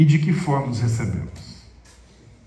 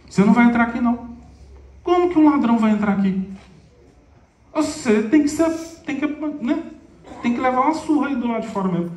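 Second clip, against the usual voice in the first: harsh refusal; 8 semitones higher than usual. Retold or imitated. Pedro turning away the thief at the door, harsh refusal. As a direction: snap it out as a stern gatekeeper. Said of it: "Pedro falou".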